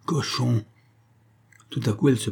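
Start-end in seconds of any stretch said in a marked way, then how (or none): 0.0-0.6 muttered insult. A narrator's reading. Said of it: "Elle ne trouvait plus autre chose et répétait".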